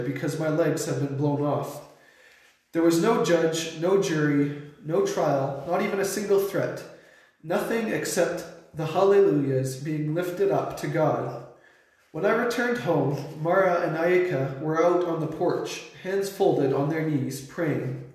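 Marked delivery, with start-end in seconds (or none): none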